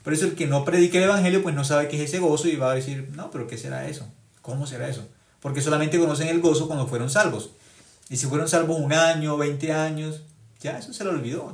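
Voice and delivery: loud, high, fervent sermon delivery; preaching at full force. Acted. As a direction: preach it out to the congregation.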